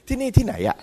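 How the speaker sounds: lightly acted confusion; mock dazed. Foggy driver arriving at work and not knowing the place, mock dazed. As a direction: play it a little lost. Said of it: "บางทีเบลอๆ".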